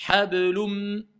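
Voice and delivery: "Hablum" with the n turned into a held m; "Hablum" closing on a long nasal hum; assimilated nun. Shown as a demonstration. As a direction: linger on the final m of "Hablum". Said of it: "je ne prononce plus le N".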